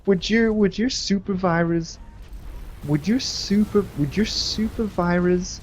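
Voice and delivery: put-on slurred, high drunk voice; hammered teen slurring a question; drunk friend. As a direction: play the drunk buddy fumbling words. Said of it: "Nick's like".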